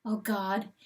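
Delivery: usual speaking voice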